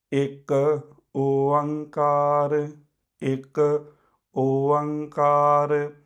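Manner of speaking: ਇੱਕ short, ਓਅੰਕਾਰ long and drawn out, repeated for the class; slow joint recitation. Shown as a demonstration. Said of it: "ਆਪਾਂ ਇਕੱਠਾ ਉਚਾਰਨ ਕਰਨਾ". (ਇਕੱਠੇ ਹੌਲੀ ਹੌਲੀ ਇੱਕ ਓਅੰਕਾਰ)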